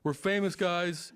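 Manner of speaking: high, loud mock announcement to a crowd; retold yelling. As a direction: imitate a friend calling out to everyone. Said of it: "Like, yelling that to people".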